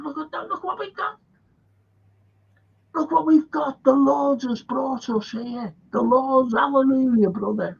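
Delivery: high, fast gushing wonder; mock awestruck delight. Pilgrims spotting Indian farmland, high awestruck gushing. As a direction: marvel like you've found paradise.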